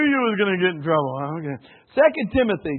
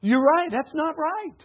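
ordinary talk, nothing marked

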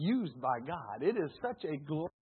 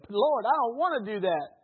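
even louder, higher whiny protest to God; reluctant refusal; 7 semitones higher, 8 dB louder than usual. Acted. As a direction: dig your heels in and whine it out. Preacher acts reluctant believer refusing.

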